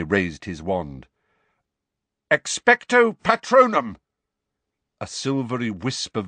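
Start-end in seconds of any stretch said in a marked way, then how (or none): 2.3-4.0 high, loud incantation; urgent, forceful spell-casting shout. A narrator's reading. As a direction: cry the spell at an oncoming threat. Harry casts spell at approaching Dementor.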